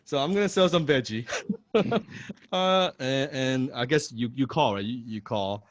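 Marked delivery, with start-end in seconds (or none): 1.3-2.5 high laugh. Real, not acted. Laughs before the sell.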